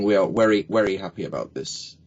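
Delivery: "very" said as "wery". Retold or imitated. Coach quotes German client, "very" as "wery". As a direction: swap the V in "very" for a W. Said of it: "a German guy I worked with".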